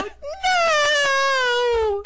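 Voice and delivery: a loud, high yelp of horror; shouted no. Retold or imitated. Stopping her friend asking the waiter, a high mortified shout. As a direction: blurt out a mortified no. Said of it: "And I go".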